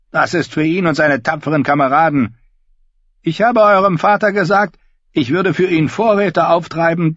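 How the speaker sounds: high, loud bleating sheep voice. Acted. Davin voiced by narrator.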